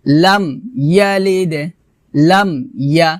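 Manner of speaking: "লাম ইয়ালিদ" with m shut, then bounced d; closed m, separated. Shown as a demonstration. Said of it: "এভাবে".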